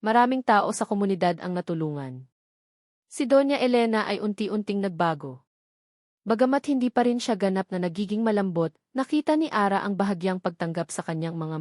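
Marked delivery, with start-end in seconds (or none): none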